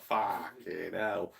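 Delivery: long, slow reaction curse; drawn-out swearing. Retold or imitated. Gamer recalling his reaction to watching scary gameplay, drawn-out swearing. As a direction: stretch both words right out. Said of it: "I was like".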